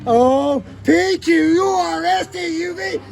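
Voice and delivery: high frantic shouting in icy water; panicked shouting. Real, not acted. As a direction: gasp the letters out in panic. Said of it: "that was panic A B Cs".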